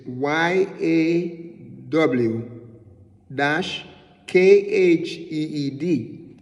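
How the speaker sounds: letter by letter, slowly; spelled out. Shown as a demonstration. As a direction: spell "Y A C H I Y D" one letter at a time.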